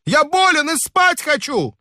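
high, exasperated complaint; a sleepless guest scolding revellers; indignant scolding. A narrator's reading. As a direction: scold them for their noise. Narrator scolding noisy neighbours.

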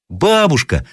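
put-on high child's cry; boy calling out. A narrator's reading. Grandson calls grandmother in the night, piping cry. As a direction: call out like an eager little boy. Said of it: "закричал опять мальчик".

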